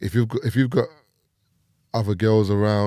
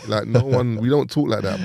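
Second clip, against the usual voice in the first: usual speaking voice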